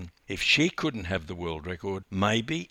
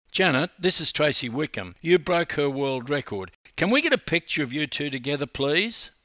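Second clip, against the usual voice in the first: quick, loud, higher-pitched; brisk introduction; 6 semitones higher, 5 dB louder, faster than usual. A narrator's reading. Journalist introducing rival swimmers for a photo, brisk. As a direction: rattle off introductions and a request.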